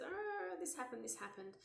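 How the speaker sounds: high-pitched mock cry sliding down into words; mock scream. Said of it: "screams and says".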